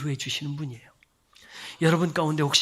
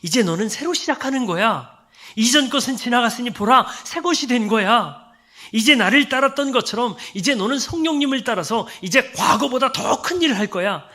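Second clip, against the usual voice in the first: louder and higher, rising to a shout; Jesus encouraging; 9 semitones higher, 8 dB louder than usual. Acted. Jesus telling Peter he will do greater things, loud and uplifting. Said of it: "그 말씀의 의미는 이런 거예요".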